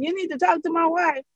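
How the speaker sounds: high, eager quoted husband. Retold or imitated. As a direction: pitch it up high and quick like the husband. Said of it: "telling them".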